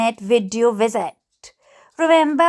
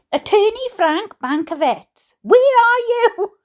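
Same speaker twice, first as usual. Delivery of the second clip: calling out; 7 semitones higher than usual. Acted. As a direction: call out for someone who vanished. Calling out for missing attorney.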